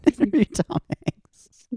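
high, slow, laughing mockery; laughing repeat. Host laughs repeating the fan question, high and slow. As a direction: giggle through it in a squeaky voice.

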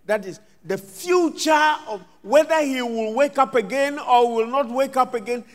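ordinary delivery

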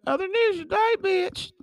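other news today sung up high and sassy; high sassy repeat. Shown as a demonstration.

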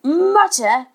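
high, loud indignant yell; exasperated outburst. A narrator's reading. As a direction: throw the word back as an angry father. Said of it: "he yelled".

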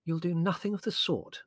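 firm. A narrator's reading. Mother forbidding Larry's letter, firmly. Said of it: "said Mother firmly".

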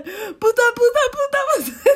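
high, loud reenacted swearing yell; retold screaming curse. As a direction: scream it like you're tumbling in the wave. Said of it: "Empiezo a gritar".

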